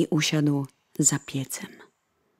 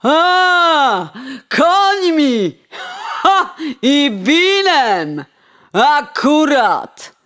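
high, loud, drawn-out jeer; coachman's mocking shout; 9 semitones higher, 6 dB louder, much slower than usual. Coachman jeering at the sparrow, loud and slow. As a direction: sneer at the little bird from the cart.

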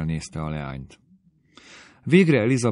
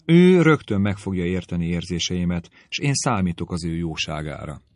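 ordinary delivery

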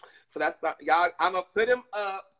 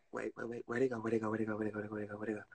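muttering to herself; 7 semitones lower, 12 dB quieter, much faster than usual. Real, not acted. Muttering while searching phone.

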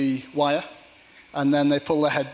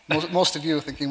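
ordinary delivery

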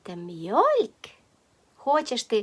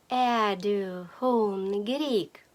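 "Är du hungrig" very slowly, syllables drawn out; slow teaching take; much slower than usual. Shown as a demonstration. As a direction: stretch out "Är du hungrig" for learners to repeat. Phrase repeated slowly for learners. («Är du hungrig» повільно й протяжно)

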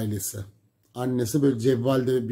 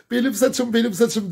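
high, loud panicked cry; pained protest; 10 semitones higher, 5 dB louder than usual. Retold or imitated. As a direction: cry out as your hair is yanked. Girl cries out while hair pulled. (saçı çekilirken çığlık atan kız)

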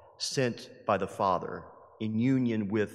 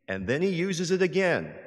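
higher and louder, pressing the point home; building emphasis; 5 semitones higher, 3 dB louder than usual. Acted. Preacher stressing to the congregation, rising and louder. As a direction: hammer the repeat harder. Third repeat, climax of the build.

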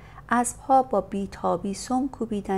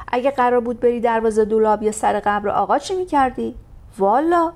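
loud, high, fast scolding tirade; shrill berating; 6 semitones higher, 8 dB louder than usual. Acted. Lady berates the coachman for whining about a short trip. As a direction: give him a piece of your mind.

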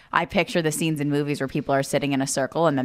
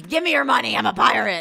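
higher, hammed-up pirate demand; 5 semitones higher than usual. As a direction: play a playful kid pirate. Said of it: "that's what you're like".

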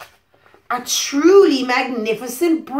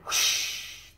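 whispered whoosh, much softer; breathy whoosh; 9 dB quieter than usual. Acted. Reading to a child, a soft whispered whoosh. Broom takes off.

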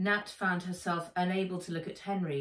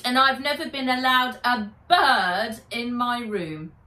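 put-on louder, resentful girl voice; bitter, building louder to the end; jealous rant; 4 semitones higher, 8 dB louder than usual. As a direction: throw every grievance at her.

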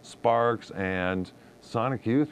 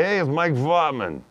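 slow Boston accent, a touch louder; 4 semitones higher, 4 dB louder than usual. Shown as a demonstration. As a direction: lean into a Boston guy intro. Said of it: "My Boston guy".

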